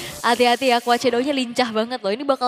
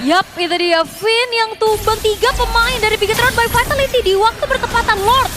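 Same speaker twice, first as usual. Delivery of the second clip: higher and louder hype caster call; excited play-by-play; 6 semitones higher, 4 dB louder than usual. Real, not acted. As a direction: build the momentum like a caster. Co-caster hyping the swing. (komentator esports yang berapi-api)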